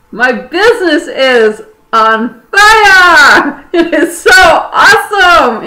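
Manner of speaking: high, loud, exultant hype; excited shouting. Shown as a demonstration. Coach showing viewers how to celebrate success, exultant. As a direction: shout it out, stretching the big words. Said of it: "when you're acting like that".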